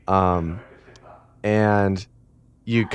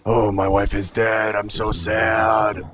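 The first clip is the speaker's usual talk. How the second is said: slow put-on moan, last words stretched way out; hammed-up throaty wail of grief; mock really sad; slower than usual. Imitating Boone lamenting his dead wife, mock really sad. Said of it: "you know, really sad".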